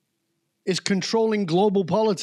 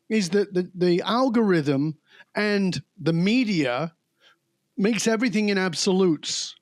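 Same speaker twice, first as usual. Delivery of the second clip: ordinary talk, nothing marked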